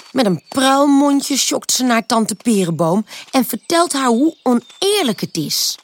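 ordinary delivery, nothing marked

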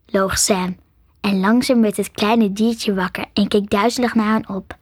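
usual speaking voice

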